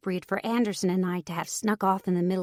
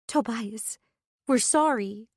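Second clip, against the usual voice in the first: higher, pleading apology near tears; holding back tears; 4 semitones higher, slower than usual. Acted. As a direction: fight the tears as you apologize. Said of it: "trying to hold back the tears".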